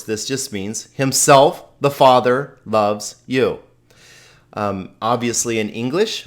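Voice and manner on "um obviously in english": normal talking voice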